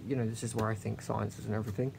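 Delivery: dropped to a quick, soft murmur; half-whispered confiding aside; hushed aside. Real, not acted. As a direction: lean in and say it softly, a little fast. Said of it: "Why am I whispering?".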